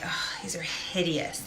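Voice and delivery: breathy whisper of dismay; whispered aside. Retold or imitated. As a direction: whisper it so nobody hears. Looking at ugly card photos.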